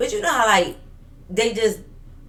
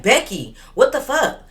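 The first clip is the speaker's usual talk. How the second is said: higher, louder indignant call; retold yell; 7 semitones higher, 5 dB louder than usual. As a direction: yell her name in exasperation, as retold. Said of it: "So when I yelled her name".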